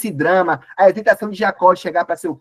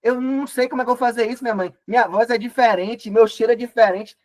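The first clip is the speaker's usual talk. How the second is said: higher, fast, worried protest; a nervous son pleading with his mother; anxious young Jacob; 6 semitones higher than usual. Acted. Jacob frets to his mother about fooling his father, higher and hurried. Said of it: "E Jacó fala".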